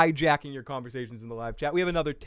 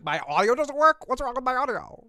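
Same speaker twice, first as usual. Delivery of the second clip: mock whiny quote; 10 semitones higher than usual. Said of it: "I was like".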